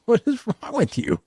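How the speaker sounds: high, playful mock disbelief; a high, joking squeak of disbelief; joking mock outrage. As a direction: tease her with a squeaky high what's wrong. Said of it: "I just said it jokingly".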